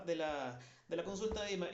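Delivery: ordinary talk, nothing marked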